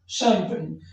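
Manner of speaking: शैंपेन with soft sh, "shempen"; correct pronunciation. Shown as a demonstration. Teacher repeating champagne with its sh sound. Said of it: "यहाँ पर इसको आप पढ़ते हैं शैंपेन".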